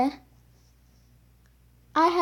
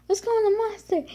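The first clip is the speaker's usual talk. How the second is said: higher, bright call to go; eager suggestion; 8 semitones higher, faster than usual. Retold or imitated. Kid urging mom toward the ride, excited high voice. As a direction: pitch it up, keen to ride. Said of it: "I'm like".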